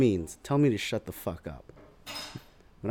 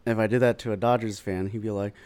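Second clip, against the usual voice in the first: ordinary talk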